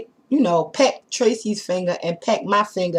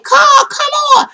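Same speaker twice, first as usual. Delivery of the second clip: high, loud shout to hurry; urgent high shout while fleeing; shouting urgently; 7 dB louder than usual. Retold or imitated. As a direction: yell at her to keep up. Friend urging her to run.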